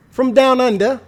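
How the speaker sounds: hammy high fake Australian accent; loud mock Aussie accent. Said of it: "Was that a good accent?".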